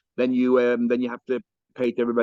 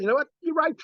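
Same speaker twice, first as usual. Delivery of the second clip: bright, lifted "you're right", jumping higher on the last words; cheerful concession; 14 semitones higher than usual. Retold or imitated. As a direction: play the rabbi happily giving in overnight. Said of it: "in the morning, he says".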